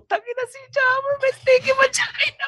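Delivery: mock the friend behind her back, high; catty gossip voice. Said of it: "Pero pag alis mo".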